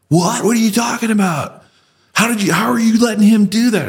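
mock the troops' disbelief, way up high; incredulous soldiers. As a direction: soldiers shocked the officer gave in, squeaky disbelief.

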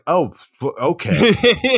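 slow, laughing mock fright; mock-scared okay, laughing; laughing fake fear. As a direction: stammer through a laugh. Startled reply to armed kids.

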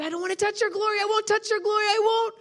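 high, insistent, rising pleading; frantic protest. Retold or imitated. Preacher pleads with God not to take his glory, frantic protest. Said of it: "I said".

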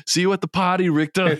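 high, mock action-hero one-liner; Quaid. Retelling Quaid's line to falling Richter, mock heroic. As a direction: deliver the kill line high and hammed-up. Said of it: "he delivers the line".

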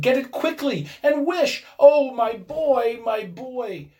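high, loud, desperate plea; urge, then stretch into a grieving cry; panted urgency. A narrator's reading. Bereaved mother begs for her boy, desperate. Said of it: "she panted".

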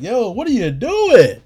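high, drawn-out outraged yell; retold yell. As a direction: yell it in mock disbelief, stretching the words. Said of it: "I shouldn't have yelled".

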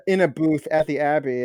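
ordinary delivery

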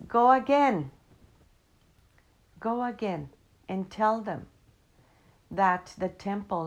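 loud, forceful order to go back; the Great Mother insisting, pushing him onward; firm command. Acted. Great Mother orders Juan Diego back, loud and firm.

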